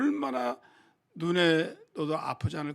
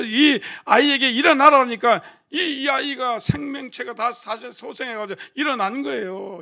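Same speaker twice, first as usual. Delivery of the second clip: loud, higher preaching, pressing the point; full-force preaching; 7 semitones higher, 8 dB louder than usual. Acted. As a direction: preach it out to the whole congregation.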